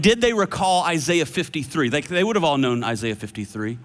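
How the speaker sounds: ordinary talk, nothing marked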